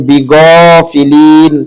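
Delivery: বিগফিলিন drawn out slowly with a heavy গ; বিগফিলিন, গ said heavy, not as গা; heavy ghain. Shown as a demonstration. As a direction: stretch বিগফিলিন, heavy and slow. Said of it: "গা উচ্চারণ করা যাবে না".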